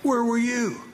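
high, pleading shout at heaven; angry cry to God. Retold or imitated. Soldier cries out to God after losing his men, high anguished shout. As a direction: fling the question up at God in anguish. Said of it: "I was angry with God".

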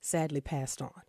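hushed tragic news; whispering. Real, not acted. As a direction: keep it low and hushed. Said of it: "I don't know why I have to whisper this".

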